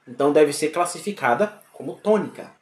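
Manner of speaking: normal talking voice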